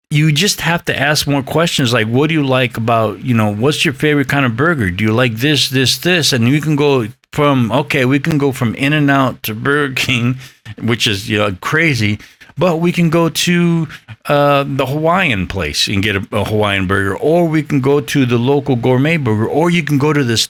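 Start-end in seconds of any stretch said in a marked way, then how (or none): none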